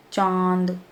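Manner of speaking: चांद with its vowel sounded through nose and mouth together; nasal vowel demo. Shown as a demonstration. Teacher asks pupils to say it, nasalised vowel demo. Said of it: "बोल के देखो".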